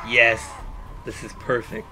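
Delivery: low, hushed, pleased murmur to oneself; low quiet inner voice. Pretend. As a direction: voice his thoughts softly and low. Voicing the motionless game character's thoughts.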